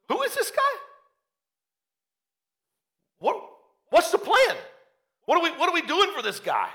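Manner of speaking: high-pitched incredulous questioning. Acted. David hearing Goliath's threats, incredulous. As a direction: squeak out the questions in acted disbelief. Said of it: "It's almost like incredulous".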